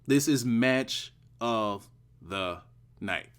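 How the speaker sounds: "match of the night" slowed, word by word; deliberate, spaced-out "This is match of the night"; emphatic slow repeat. Real, not acted. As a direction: drive the verdict home with pauses before "night".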